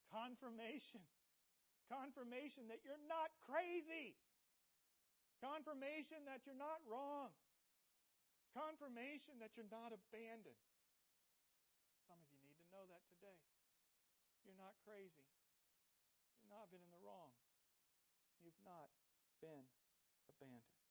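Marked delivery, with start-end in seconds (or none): 0.1-10.6 higher and louder, hammering each line; passionate refrain. Real, not acted. Preacher assuring the doubting faithful, rising fervor. 14.4-20.8 gentle reassurance. Real, not acted. Softer echo of refrain.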